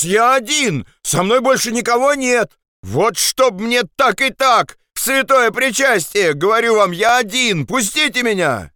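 high-pitched pleading shout; desperate insistence. Acted. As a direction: swear it at a high, urgent pitch. Andres swears, begging to pass. (отчаянный крик с мольбой)

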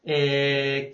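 ordinary delivery

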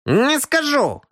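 put-on boy snaps back, very high; a brat refusing with his tongue out; snapping. Boy refuses the detective, snapping. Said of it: "огрызнулся мальчик".

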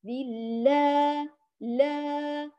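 slow بالله with a straight open "laa"; upright alif. Shown as a demonstration. Teacher models the alif standing upright, open aa. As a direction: release the aa of لا outward, fully open. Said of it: "قائمة".

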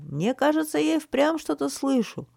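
high, excited calls building to a loud shout; Yantye calling out. A narrator's reading. Lookout on tower announcing the returning crowd, excited shouting. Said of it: "крикнул Янтье".

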